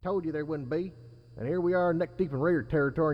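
distant voice. A narrator's reading. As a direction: heard from across the room. Second distant raider.

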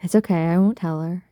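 ordinary talk

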